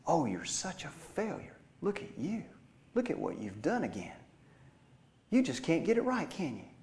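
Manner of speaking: hushed, mocking accuser, pitch swinging upward; condemning voice. Accuser in your head belittling you, condemning voice. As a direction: needle them softly with rising, taunting reproach. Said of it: "He won't say things like".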